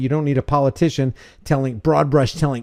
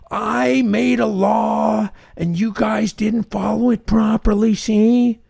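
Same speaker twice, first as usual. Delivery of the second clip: high, slow tough-guy voice; mock gangster lawmaker; 7 semitones higher, slower than usual. Lawmaker scolds the public in a mock tough-guy voice. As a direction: play the lawmaker as a cartoon boss.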